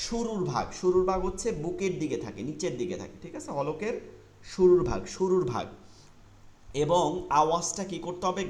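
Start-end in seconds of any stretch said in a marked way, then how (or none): none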